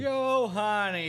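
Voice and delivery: loud, high, drawn-out hail; long, stretched-out cheer; rousing name cheer. Pretend. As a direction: holler it like a crowd greeting a regular. Hosts hail their regular caller.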